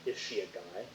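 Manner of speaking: low, quiet aside, lifting at the end; deadpan retort. Retold or imitated. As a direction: drop it low and soft as a question.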